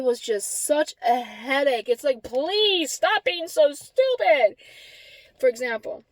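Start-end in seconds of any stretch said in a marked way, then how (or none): 2.2-4.6 drawn-out, higher exasperated plea; fed up, stretching out the words. Retold or imitated. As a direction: plead slowly, at wits' end. Venting about his stupidity.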